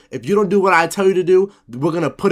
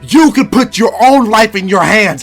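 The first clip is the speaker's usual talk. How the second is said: much louder and higher, fast yelling; furious shouting threat; 9 semitones higher, 10 dB louder than usual. Acted. Captor threatening SpongeBob to take his anger out, furious shout. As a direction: bellow the threat fast and hard. Urging SpongeBob to self harm.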